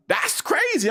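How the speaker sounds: high, astonished exclaim; incredulous. Real, not acted. Telling about family thinking he left Christ, incredulous high exclaim. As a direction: voice jumps up in disbelief.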